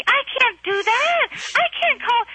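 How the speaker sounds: high mock husband protest; whiny husband. Husband refusing to phone the old lady, high mock whine. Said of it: "And he's like".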